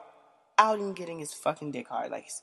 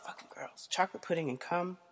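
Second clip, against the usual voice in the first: whispered under her breath; 6 dB quieter than usual. Real, not acted. Whispering her disgust to the listeners. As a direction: hiss it to herself in disgust.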